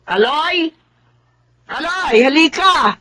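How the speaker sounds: high calling voice. Acted. Aunt calling the adopted boy over, calling out. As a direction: call him over from inside.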